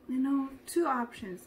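ordinary talk, nothing marked

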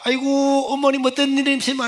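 evangelist's greeting. Retold or imitated. Evangelist greeting the mother after service, high eager greeting.

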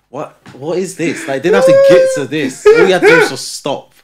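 loud, high exasperated rant; loud high exasperation at a toddler. Retold or imitated. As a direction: throw up your hands, pitched high.

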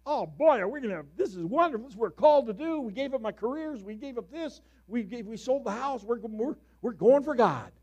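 high, loud, rushing with excitement; eager young pastor. Retold or imitated. Young pastor imagining his new church, high and loud with excitement. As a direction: gush like a new pastor sure of success.

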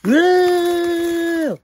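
high loud death scream; horror scream. Acted. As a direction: scream as you die.

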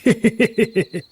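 high, soft gloating cackle; sly cackle. Acted. Servant gloats to the babu about the murders, sly cackle. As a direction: chuckle at getting away with it. Servant laughs after the crime.

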